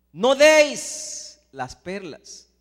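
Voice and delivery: very slow, loud, every word weighed; solemn command. Acted. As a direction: stretch out the warning word by word. Repeating Jesus' command. (orden solemne, muy lenta y fuerte)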